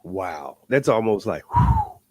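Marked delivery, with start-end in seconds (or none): 1.5-2.0 a big high whistling whew of amazement; overwhelmed exhale; amazed whew. Real, not acted. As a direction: let the breath out high and long, blown away. Overwhelmed by the testimony.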